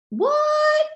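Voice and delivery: high, loud startled "What? No"; husband caught off guard, insisting nobody dies; shocked denial. Retold or imitated. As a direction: play the husband's alarmed cover-up.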